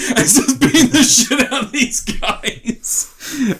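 high laughing delivery, slowed. Real, not acted. Retelling Alan's fight to a friend, laughing. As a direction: laugh through the words.

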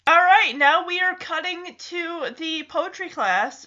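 usual speaking voice

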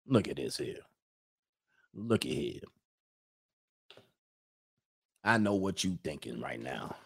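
low, quiet, menacing Mississippi lawman; Mississippi cop accent. Acted. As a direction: threaten the pulled-over driver softly in a Mississippi accent. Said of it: "That's how they do you. They pull you over".

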